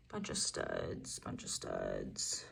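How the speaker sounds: very low, slow, growly silly voice; deep goofy voice. Pretend. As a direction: drop way down and drawl it. Silly voice for the earrings.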